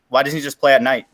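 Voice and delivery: normal talking voice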